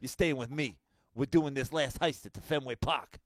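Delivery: loud, hammed-up Boston crook; Jeremy Renner. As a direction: fake Boston accent, movie tough guy. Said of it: "like, fake Boston".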